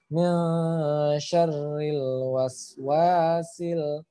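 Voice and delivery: very slow syarril with lam held, not bounced; slow recitation. Shown as a demonstration. Teacher reciting, lam not bounced. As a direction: chant mil syarril slowly. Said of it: "perhatikan panjang pendeknya".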